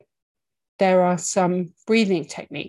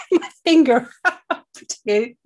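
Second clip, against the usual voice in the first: giggling through the words; laughing; 10 semitones higher, 5 dB louder than usual. Real, not acted. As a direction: breaks into a laugh mid-sentence. Catches herself mid-gesture, laughs.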